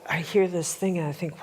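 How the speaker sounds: normal talking voice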